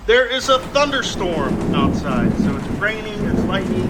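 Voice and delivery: ordinary talk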